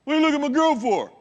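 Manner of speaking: loud, high macho challenge; jealous shout. Retold or imitated. Young guy confronting a stranger staring at his girlfriend, jealous shout. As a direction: bark it out like a jealous boyfriend. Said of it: "bows up and goes".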